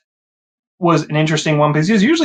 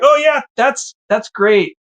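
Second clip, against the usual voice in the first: forced bright reply, pitched way up; fake cheerful; 9 semitones higher, slower than usual. To the overseer's wife, hiding panic, forced chirpy cheer. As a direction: cover the panic with a chirpy, too-high yes. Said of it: "I'm pretending to just pretend like".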